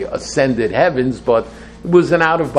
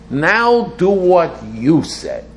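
high, slow, emphatic demand; challenging demand; 6 semitones higher, 3 dB louder, slower than usual. Acted. Worshipper telling God it's his turn, challenging demand. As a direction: hit "Now" high, stretch the words, fall at the end. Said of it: "So I'm challenging God to say".

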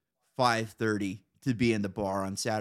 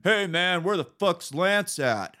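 higher, louder, slow put-on voice of a visitor; mock neighbor; 7 semitones higher, 5 dB louder, slower than usual. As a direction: call through the door for Lance. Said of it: "people knocking on my door".

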